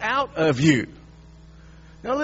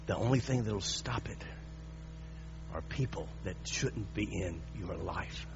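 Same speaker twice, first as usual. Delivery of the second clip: intimate quiet preaching; 10 semitones lower, 8 dB quieter than usual. Acted. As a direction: draw the congregation in close.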